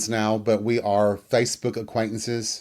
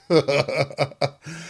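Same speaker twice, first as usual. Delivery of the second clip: helpless real giggle after a shot; 4 semitones higher than usual. Real, not acted. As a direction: laugh it out, tipsy and giddy. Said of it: "I don't know why I'm laughing".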